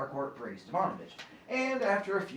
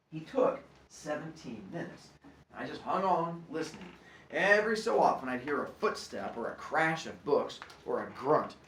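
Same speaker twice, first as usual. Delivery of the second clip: usual speaking voice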